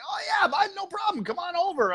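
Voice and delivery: high, hearty put-on phone voice; fake old-pal greeting. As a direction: play along as the caller's buddy. Playing along with wrong callers.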